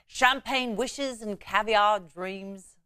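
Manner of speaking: slow, hammed-up English accent catchphrase; a deliberately bad English accent, drawn out; horrible English accent. Anchor imitating the late TV host's catchphrase in a bad English accent. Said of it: "that's a horrible English accent".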